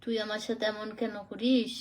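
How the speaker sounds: a light Rangpuri accent demo; Rangpur dialect. Shown as a demonstration. Asked to speak Rangpuri, she demonstrates in a Rangpur accent. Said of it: "এরকম, এটা রংপুরের ভাষা".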